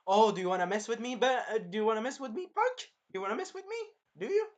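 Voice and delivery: high-pitched, rising goading; mock taunting. Hero goading the dragon, high mocking taunt.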